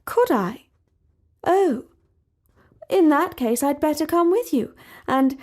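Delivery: a hint of the royal princess; prim princess. A narrator's reading. Princess agreeing to come along, prim.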